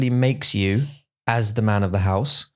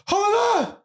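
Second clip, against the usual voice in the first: mock cheery greeting. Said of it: "You go".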